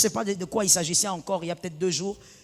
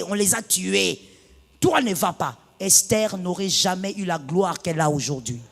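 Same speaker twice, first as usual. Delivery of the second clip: ordinary talk, nothing marked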